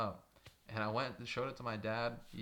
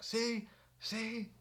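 mock gloating; 12 semitones higher than usual. Manager imitated telling girlfriend.

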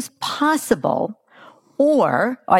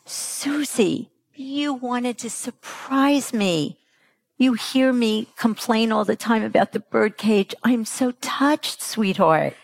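understanding mother; 3 semitones higher than usual. Acted. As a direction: tell a child you are touched, gently. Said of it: "Hannah could have said".

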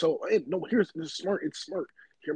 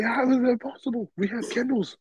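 higher, louder astonished voice; mock amazed villager; 8 semitones higher, 4 dB louder than usual. Friend voicing people seeing a lighter, higher amazed. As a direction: gasp it out in wonder.

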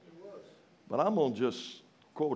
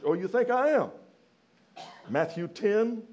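loud, high preaching challenge; preaching at full force; 10 semitones higher, 7 dB louder than usual. Acted. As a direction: fire it back at the congregation.

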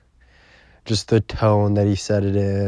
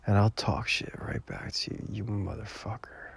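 menacing whisper, softer and unvoiced; whispered threat; 12 dB quieter than usual. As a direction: hiss the threat as a joke. Mock threat aimed at friend Noah.

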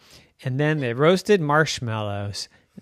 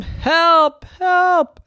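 high, loud panicked shouts; frantic cry for help; 9 dB louder than usual. Acted. Unicorn calls for help.

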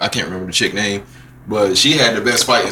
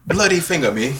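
mock British; 8 semitones higher than usual. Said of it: "only term I know is bloody".

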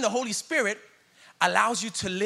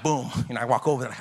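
ordinary talk, nothing marked

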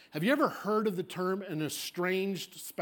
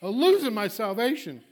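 mock afraid; 7 semitones higher, 7 dB louder than usual. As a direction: wail it like an overwrought churchgoer. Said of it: "I'm so afraid".

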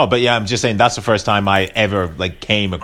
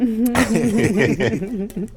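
breathless laugh; 14 semitones higher than usual. Real, not acted. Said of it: "let me catch my breath".

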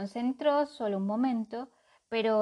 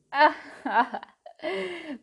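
high burst of laughter; loud laugh; 7 semitones higher, 6 dB louder than usual. A narrator's reading. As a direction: laugh out at the crow. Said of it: "lanzaron una carcajada".

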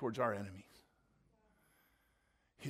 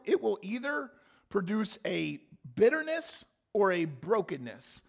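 usual speaking voice

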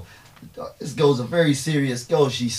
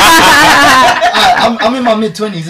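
flustered age reveal; 12 semitones higher, 15 dB louder, slower than usual. Real, not acted. Embarrassed to reveal his age.

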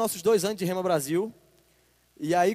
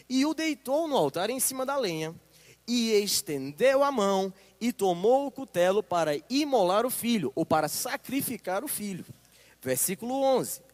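normal talking voice

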